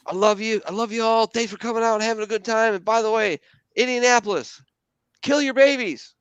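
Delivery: high, mock comedian sign-off; hammed-up stage farewell; mock Bill Burr closer. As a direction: play the comic's sweet goodbye, then the twist. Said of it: "And he goes".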